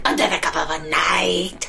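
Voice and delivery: hushed sneaky ninja voice; breathy secret whisper. Pretend. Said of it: "is that your ninja voice".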